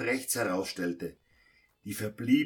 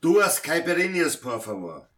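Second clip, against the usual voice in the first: loud call for two drinks across the bar; 4 semitones higher, 5 dB louder than usual. Acted. Pedro calls loudly across to the bar for drinks. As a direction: call out the order over the noise.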